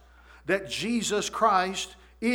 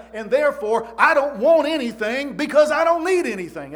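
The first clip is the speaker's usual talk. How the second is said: raised, loud preaching climax; emphatic preaching; 4 semitones higher, 5 dB louder than usual. Retold or imitated. Preacher voices David's contentment to the congregation, emphatic. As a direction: build it high and loud as a firm conclusion.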